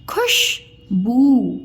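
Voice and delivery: खुशबू split slowly into खुश and बू; syllable by syllable. Shown as a demonstration. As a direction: sound out Khushboo with a pause before बू. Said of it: "उच्चारण ऐसे में करके बताया करूँ". (खुशबू को अलग-अलग तोड़कर, धीरे)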